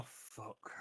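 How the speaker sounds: very soft, unvoiced curse under the breath; hushed, sinking realisation; muted dismay. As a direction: swear quietly to yourself in dismay. Acting out a sheepish realisation.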